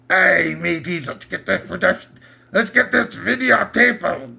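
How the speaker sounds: drawn-out, wobbly attempt at a pirate accent; hammed-up, badly faked pirate accent; botched pirate accent. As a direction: do a pirate accent that slips into no known accent. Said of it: "What the hell kind of accent?".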